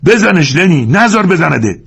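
high, loud urging; shouting. Acted. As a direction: shout encouragement across the room. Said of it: "فریاد کشید".

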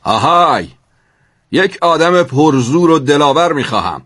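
high commanding call; captain calling out for a volunteer. A narrator's reading. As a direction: hail everyone on deck as captain. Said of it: "صدای ناخدا بلند شد".

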